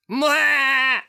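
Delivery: high loud goat bleat instead of a roar. Acted. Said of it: "instead you hear this".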